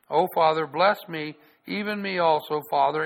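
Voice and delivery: loud begging voice; pleading cry. Acted. Esau pleads again.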